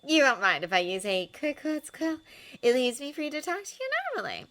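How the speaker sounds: sly journalist fishing for a reaction; Rita Skeeter. A narrator's reading. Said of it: "said Rita".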